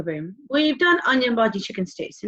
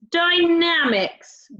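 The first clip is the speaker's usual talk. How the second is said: Dynamics drawn out slowly and higher; 6 semitones higher, 3 dB louder, much slower than usual. Shown as a demonstration. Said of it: "Can you say dynamics?".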